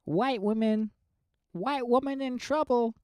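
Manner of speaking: high, sing-song mock panic; mock alarm. Co-host mocking the clip.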